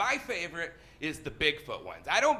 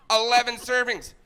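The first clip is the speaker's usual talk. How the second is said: higher, louder disbelief; incredulous outburst; 6 semitones higher, 7 dB louder than usual. Acted. Comic astonished by the lasagna box, loud incredulous repeat. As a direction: burst out in mock outrage at it.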